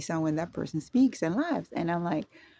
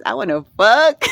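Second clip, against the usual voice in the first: laughing; 3 semitones higher, 10 dB louder than usual. Real, not acted. Embarrassed confession, laughing.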